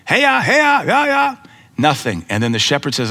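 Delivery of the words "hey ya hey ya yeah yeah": high calling out hey-ya to the flock; loud, high shepherd's call; imitating a stranger's sheep calls. Preacher mimics a stranger calling sheep, loud high calls. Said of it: "whistle and call and do everything the shepherd does".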